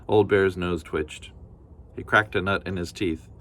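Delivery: usual speaking voice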